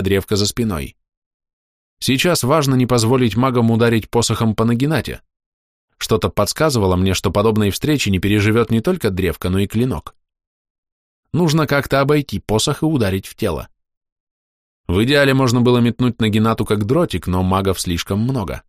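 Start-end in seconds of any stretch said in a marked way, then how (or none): none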